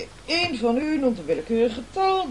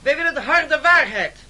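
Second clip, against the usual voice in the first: rally shout; 3 semitones higher, 7 dB louder than usual. Acted. Rally heckler demanding truth.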